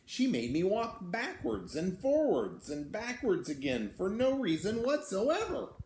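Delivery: put-on lively read-aloud for children; storybook reading. A narrator's reading. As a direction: tell it like a picture book to kids. Reads the beast's tale aloud.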